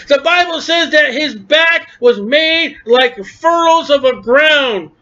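high, emphatic pulpit cry; fervent preacher at full force; impassioned preaching. Real, not acted.

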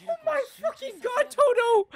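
very high, squeaked disbelief; horrified squeal. Real, not acted. Viewer seeing Todo take a hit, horrified squeal. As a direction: cry out for him in a high squeak.

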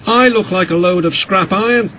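high, woebegone engine voice; groaning. A narrator's reading. Oliver bemoaning his damage, a high groan. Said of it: "groaned Oliver".

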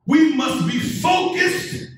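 ordinary talk, nothing marked

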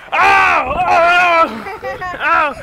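loud, high excited yelling; excited shout. Real, not acted. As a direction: yell it out, thrilled at being caught. Caught in tag, shouting.

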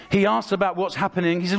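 ordinary delivery

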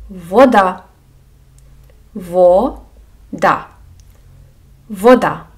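вода modelled clearly, open o and stressed final а; careful Ukrainian word. Shown as a demonstration. Teacher repeats вода for learners, clear model. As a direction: say вода slowly and distinctly for students. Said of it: "Або у слові".

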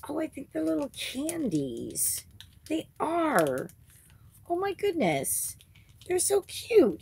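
high, gushing over the candies; delighted. Real, not acted. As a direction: squeal over something adorable.

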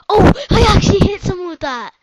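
shocked at hitting someone; surprised cry. Real, not acted. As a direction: yelp in delight at the hit. Surprised at landing hit.

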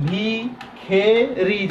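ভিখেরি split into ভি, খে, রি, very slow; ভিখেরি stretched out, mid vowel খে; variant pronunciation demo. Shown as a demonstration. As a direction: pause between the syllables of ভিখেরি. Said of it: "যদি আমি ভিখেরি বলি".

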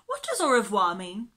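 loud, carrying question; girl calling a question into the next room. Acted. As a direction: ask across the house. Jane calls her question.

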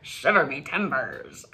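ordinary talk, nothing marked

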